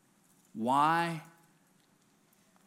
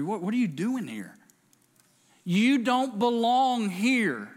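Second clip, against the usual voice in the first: slow, loud and much higher; the preacher booms God's rebuke at the church; preaching at full force; 9 semitones higher, 5 dB louder than usual. Acted. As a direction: hammer each word out slowly.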